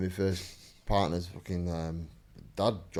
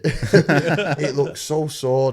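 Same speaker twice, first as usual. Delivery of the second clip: laughing; 6 semitones higher, 9 dB louder, much slower than usual. Retold or imitated. Daughter quoted, laughing.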